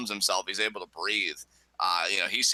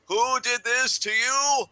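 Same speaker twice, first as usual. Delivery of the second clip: high, slow, drawn-out shout; imitating Mac's outburst; 12 semitones higher, 6 dB louder, slower than usual. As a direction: bellow each word in mock outrage. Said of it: "And then, like you said".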